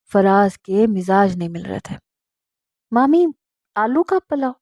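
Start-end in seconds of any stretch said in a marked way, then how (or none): 2.9-4.6 high, rising sniff of distaste; disdainful question. Acted. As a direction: turn your nose up at the dish. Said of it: "Faraz ने मुंह बना लिया". (नाक-भौं सिकोड़ते हुए ऊँचा सवाल)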